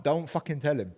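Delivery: ordinary talk, nothing marked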